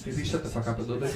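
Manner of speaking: soft laughing rebuke to the crowd; quiet shush. Real, not acted. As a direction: hush them under your breath. Hushing a noisy audience.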